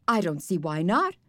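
ordinary delivery

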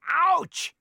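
high, loud fake yelp. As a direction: yelp though nothing hurt. Ferret cries out, untouched.

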